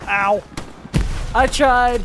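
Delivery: loud, high pained yelp. Real, not acted. Hit in game.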